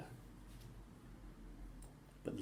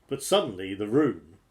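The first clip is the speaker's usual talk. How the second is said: plain reading voice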